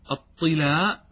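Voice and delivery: "الطلاء" said as tilaa, with kasra on the ta and a long final a; الطلاء بكسر الطاء مع المد. Shown as a demonstration. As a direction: say "الطلاء" as ṭilāʾ, the ta with i. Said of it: "بكسر الطاء".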